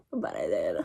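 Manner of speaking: breathy whispered aside; whispering. Real, not acted. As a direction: whisper it to yourself. Quiet triumphant aside.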